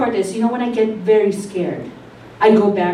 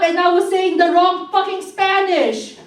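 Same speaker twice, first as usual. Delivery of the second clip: normal talking voice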